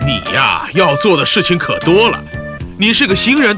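ordinary talk, nothing marked

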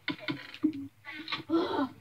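long exhale of relief; heavy sigh. Acted. Mother after hanging up on the bully, heavy sigh. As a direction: let out a big breath.

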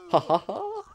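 high-pitched triumphant laugh; gloating "ha ha ha". Pretend. Player after firing on the enemy, gloating laugh. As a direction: crow with three high ha's.

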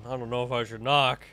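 normal talking voice

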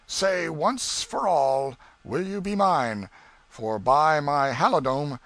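loud, slow villain's ultimatum; menacing demand. Acted. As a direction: press the captive for an answer, forcefully. Villain threatens captive to marry.

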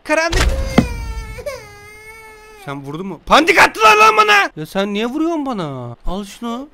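lightly voiced storybook narration; reading to a child. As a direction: read the bedtime tale aloud.

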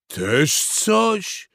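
high, offended exclamation; scornful cry. A narrator's reading. Father beaver rebuffing the rooster, crying out. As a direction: scoff it out loud. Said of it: "krzyknął tata Bóbr".

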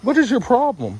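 indignant. Real, not acted. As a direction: demand what's wrong with her. Reacting to being pelted.

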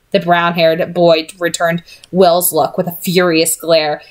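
ordinary delivery

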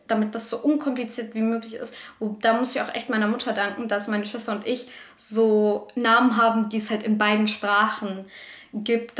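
usual speaking voice

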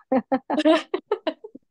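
light laugh in a quick burst. Real, not acted. Author amused by her own personal archive, laughing. Laughs after joking about no sequel.

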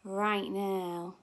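usual speaking voice

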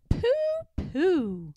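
put-on a high, scoffing little girl's pooh; scornful pooh. A narrator's reading. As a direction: brush off the tiger with a squeaky scoff. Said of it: "Madeline just said".

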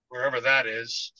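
Maria, a hint of a tearful working-class maid; Maria's own words, shaken; Maria's phrasing. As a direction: wherever that is, lightly voiced as the weeping maid. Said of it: "as Maria might say".

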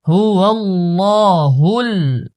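হুয়াল্লাহুল with the first lam thick, the next lam thin; heavy then light lam. Shown as a demonstration. Teacher shows students the thick and thin lam, careful recitation. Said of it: "প্রথম লাম মোটা, তারপরের লাম পাতলা".

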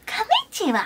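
high-pitched mock Japanese greeting; Japanese impression. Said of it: "Japanese next".

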